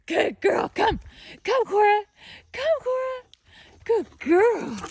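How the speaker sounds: very high, bright sing-song calls to the dog; high dog praise. Real, not acted. Owner calling Cora to come, high sing-song. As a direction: coax the puppy over in a squeaky voice.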